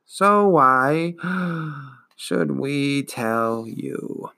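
slow, dragging, wheezing ancient voice; wheezing old turtle. Acted. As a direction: drawl it out like a weary old beast. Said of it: "Morla wheezed".

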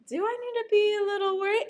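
mock worry. Retelling own thought about a funnier rival, mock worried.

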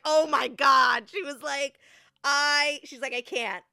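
overwhelmed disbelief; stunned. Retold or imitated. Stewardess to the narrator, overwhelmed by the ordeal.

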